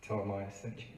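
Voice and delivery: usual speaking voice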